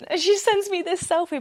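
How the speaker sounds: normal talking voice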